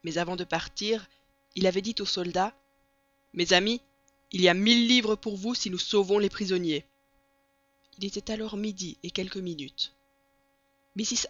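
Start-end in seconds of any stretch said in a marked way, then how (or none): 3.3-6.9 loud rallying announcement; raised-voice promise to the men; addressing troops. A narrator's reading. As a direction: project it to a group of soldiers. Fogg addresses the soldiers before leaving.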